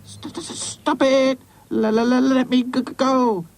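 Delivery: high-pitched, stuttered protest; crackling stuttering doll. Acted. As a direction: stammer like a faulty radio. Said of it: "crackled Hi-Fi".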